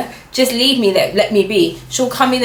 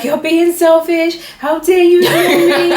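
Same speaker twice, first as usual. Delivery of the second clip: mock shouting; 9 semitones higher than usual. Retelling how she yells at girlfriend, in a mock laughing shout. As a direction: play-act yelling at your partner, half laughing. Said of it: "I'll just shout at her".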